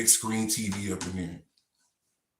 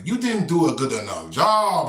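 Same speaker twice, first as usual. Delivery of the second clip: mock complaint; 6 semitones higher, 7 dB louder than usual. House mouse complaining.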